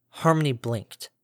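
plain reading voice

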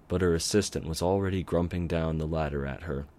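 plain reading voice

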